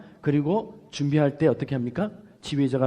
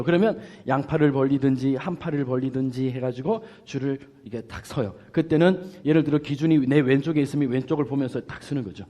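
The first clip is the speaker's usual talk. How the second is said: normal talking voice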